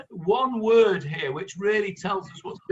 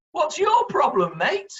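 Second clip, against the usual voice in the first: cocky, raised, louder taunt to the people you robbed; brazen challenge; 7 semitones higher, 4 dB louder than usual. Retold or imitated. Teacher voicing the thieves taunting their victims, brazen and cocky. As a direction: toss it back at them like they're the trouble. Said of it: "you turn to them and you say".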